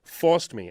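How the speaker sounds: forced hit high and loud; "forced" said high and loud with a falling tune; stress on forced. Real, not acted. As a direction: punch the word forced, correcting himself. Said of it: "or helped me, forced me".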